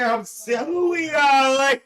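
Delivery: high, loud cheery call; calling out hello. Real, not acted.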